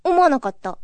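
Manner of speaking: ordinary delivery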